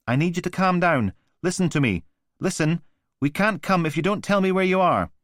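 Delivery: high, urgent phone operator voice; calm-down operator. Acted. Operator on the recording urging a panicked caller, urgent. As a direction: urge the caller to stay calm, loud and high. Emergency operator on audio file.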